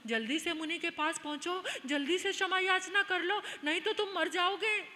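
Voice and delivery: loud, high, insistent pleading; urgent warning. Acted. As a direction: press a friend to act before he dies. Companions urge prince to apologize. (घबराई हुई ज़ोरदार चेतावनी)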